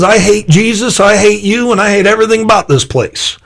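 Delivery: high-pitched retold tirade, fast at the end; hateful outburst. Shirley to the pastor, a high mock-hostile tirade. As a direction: spit it out like a hostile newcomer. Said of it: "walks up and says".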